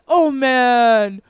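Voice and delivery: loud, high mock-Canadian exclamation; attempted Canadian accent. Retelling a creepy customer's reaction, attempted Canadian accent. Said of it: "I'm trying to do a Canadian accent".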